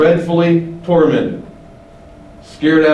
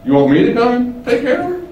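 high, rising incredulous question; incredulous general; 9 semitones higher, faster than usual. Acted. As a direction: question a subordinate's request in disbelief, pitch climbing. General's incredulous reply, acted.

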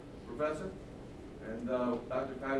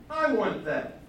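loud demand; 6 semitones higher, 5 dB louder, slower than usual. Acted. King covets again.

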